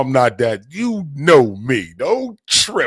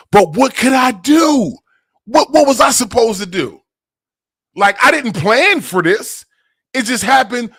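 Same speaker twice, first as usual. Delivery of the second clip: exasperated protest; 10 semitones higher, 6 dB louder than usual. Real, not acted. Defending himself to the live audience.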